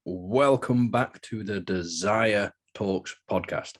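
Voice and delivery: slow, every sound of "Desire" articulated, the D clear; slow deliberate enunciation of "Desire"; carefully pronounced. Real, not acted. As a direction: make sure the D in "Desire" is heard. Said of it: "I tried to really pronunciate that one".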